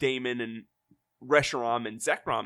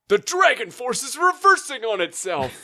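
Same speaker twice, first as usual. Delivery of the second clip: higher and louder hammed-up alarm; mock dramatic warning; 9 semitones higher, 6 dB louder than usual. Host voicing the mayor's panicked warning, hammed-up. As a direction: announce the disaster like a panicked official. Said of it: "And the mayor says".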